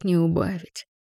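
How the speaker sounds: ordinary delivery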